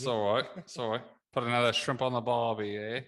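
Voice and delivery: teasing mock Australian to the guys. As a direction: play the cliché Aussie tourist ad, Australian accent. Joking in mock Aussie.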